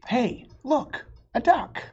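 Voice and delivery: lively, high storybook read-aloud; bright read-aloud with high character lines; reading to children. Acted. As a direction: voice the two arguing friends for kids. Reading picture book, two voices.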